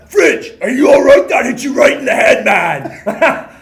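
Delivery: worried teammate bursts into the room, shouting loud and high. Retold or imitated.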